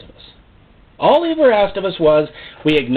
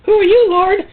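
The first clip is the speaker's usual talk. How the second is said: much higher, louder frightened cry; startled Saul; 6 dB louder than usual. Retold or imitated. Saul on the road crying out to the Lord, much higher and startled. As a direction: Saul thrown off his horse, crying out. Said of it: "Saul answers God".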